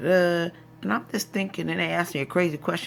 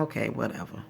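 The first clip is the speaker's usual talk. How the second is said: ordinary delivery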